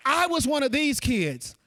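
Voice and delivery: normal talking voice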